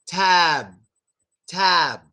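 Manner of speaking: Tab ending on slowly closed lips; Tab, b unreleased. Shown as a demonstration. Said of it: "We just slowly close our lips".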